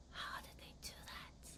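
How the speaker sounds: whispered amazement; awed whisper. Pretend. A student amazed by a third grader's math, whispering. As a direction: whisper it in disbelief. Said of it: "you're like".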